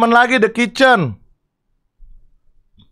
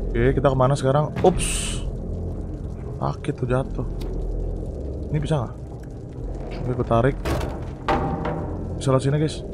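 softer and lower, almost murmured; hushed sneaking; 6 semitones lower, 11 dB quieter than usual. Real, not acted. Gamer sneaking through the level, talking softer and lower. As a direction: tiptoe with your voice.

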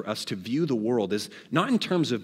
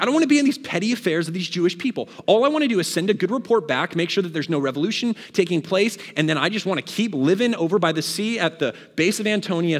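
higher, quick exasperated complaint; annoyed; 5 semitones higher, 4 dB louder than usual. Acted. Pilate fed up with these petty affairs, annoyed. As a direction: vent like someone fed up with petty business. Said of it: "He's getting a little annoyed here".

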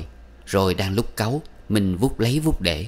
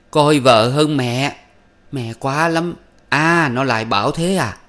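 slow, loud, higher shriek beating the floor; 6 semitones higher, 6 dB louder than usual. A narrator's reading. Mother shrieking at her son, slow and loud. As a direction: hammer out each word in fury. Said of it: "tiếng thét".

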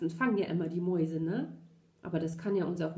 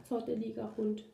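plain reading voice

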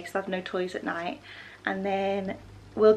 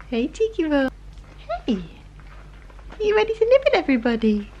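excited puppy talk; 7 semitones higher than usual. Real, not acted. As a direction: tease the pup playfully in a squeaky voice. Teasing the puppies.

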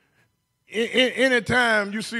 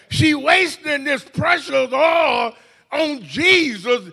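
slow, higher mock complaint; mock indignation; 6 semitones higher, slower than usual. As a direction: drag out the critics' whine. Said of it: "And they said".

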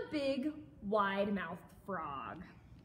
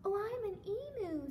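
high-pitched emu character; 10 semitones higher than usual. A narrator's reading. Emu introduces itself to frog, high voice. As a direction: answer in a high bird voice. Emu answers in picture book.

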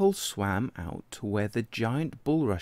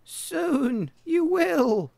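much higher and very slow lamb; the lamb promises Tadpole, much higher and slow; 5 dB louder, much slower than usual. Acted. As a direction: draw it out high and sing-song. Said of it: "said Lamb".

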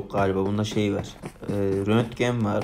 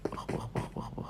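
barely voiced tense whisper; hushed urgent look look look; 14 dB quieter than usual. Real, not acted. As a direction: hiss the words under the breath.

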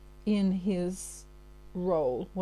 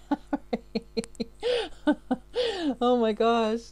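laughing; 5 semitones higher, 3 dB louder, slower than usual. Real, not acted. Laughing at her own accent.

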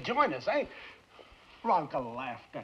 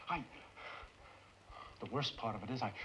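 ordinary talk

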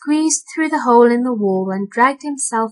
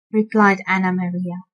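plain reading voice

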